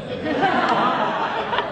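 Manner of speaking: a loud burst of real laughter; hearty laugh. Real, not acted. Laughing loudly at his joke about customers.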